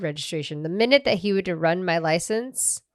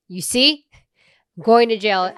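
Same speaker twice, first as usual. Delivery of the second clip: high, much louder I told you; mock accusing; 4 semitones higher, 10 dB louder than usual. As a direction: throw it at him, furious. Retold told-you-so to boyfriend.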